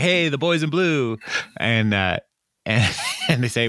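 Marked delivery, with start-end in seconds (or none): 0.0-1.2 mock cheery greeting. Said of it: "he's like".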